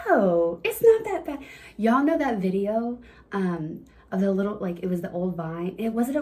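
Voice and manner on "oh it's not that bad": high, loud, pleasantly surprised "oh"; mock relieved squeal; surprised relief. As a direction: act relieved it was easy. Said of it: "you're gonna be like".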